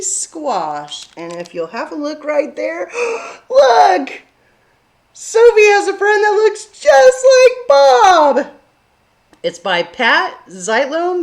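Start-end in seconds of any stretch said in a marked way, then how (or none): 3.0-3.5 high delighted gasp; big storytime gasp. Acted. 3.5-8.5 high, loud, thrilled discovery; over-the-top storytime amazement; amazed. Acted.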